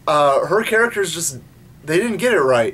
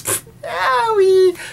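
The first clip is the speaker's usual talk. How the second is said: mock the dumb new Serena, whining high; mock ditzy Serena. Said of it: "steps on Luna and goes".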